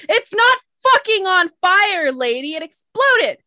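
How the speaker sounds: loud, high, exasperated shouting; furious caller. Acted. Caller correcting the dispatcher about the explosion, furious. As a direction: yell back in disbelief at being misunderstood.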